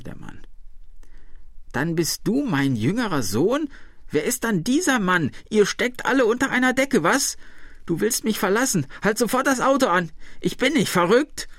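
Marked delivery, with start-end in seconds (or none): 1.7-11.5 put-on very high, frantic old woman; agitated crying out. A narrator's reading. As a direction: build to a high, indignant outcry. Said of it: "schrie die alte Frau". (aufgeregt schreiend, mit hoher Stimme)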